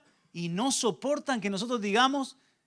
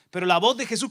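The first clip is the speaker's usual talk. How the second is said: usual speaking voice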